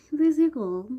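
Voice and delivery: a squealed channel tag. Pretend. Vlog sign-off catchphrase.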